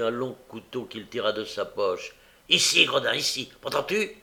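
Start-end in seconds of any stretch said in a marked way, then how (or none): 2.5-4.2 loud yelling command at the dog; angry shouted order; shouting. A narrator's reading. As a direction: bellow it like a deaf man. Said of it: "monsieur Sikes criait comme un sourd".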